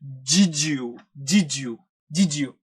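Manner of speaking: "ജിജു" blended into one word, d and y becoming j; did you as diju. Shown as a demonstration. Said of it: "ജെ sound".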